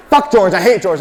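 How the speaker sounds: loud, high acted dad tirade; angry ranting. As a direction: rage at an absent neighbour, hammed up. Retold dad raging about neighbour.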